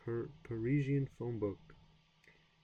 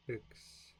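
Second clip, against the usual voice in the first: whispered try; 8 dB quieter than usual. Real, not acted. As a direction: whisper X to yourself. Whispered pronunciation attempt.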